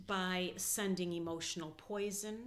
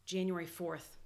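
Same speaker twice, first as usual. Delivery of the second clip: normal talking voice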